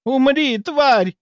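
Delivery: very high, loud battle scream; яростный крик. A narrator's reading. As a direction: scream it at the enemy. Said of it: "заорала она". (яростный визгливый крик)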